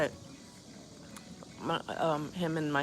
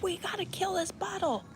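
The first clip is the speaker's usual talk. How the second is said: much higher, gleeful imitation of her friend; mock eager friend. Friend insisting they finish the bottle, giddy mock squeal.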